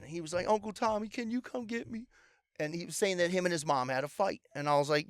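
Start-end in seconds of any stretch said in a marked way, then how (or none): 0.5-2.1 put-on high, soft, pleading child's voice; imitating crying nephew. Nephew calling his uncle for a ride, imitated as tearful. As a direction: mimic an upset kid on the phone, higher and softer. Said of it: "and he's crying".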